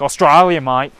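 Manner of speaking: broad Aussie accent imitation; Australian accent. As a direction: ham up a strong Australian accent. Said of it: "the more subtle accents".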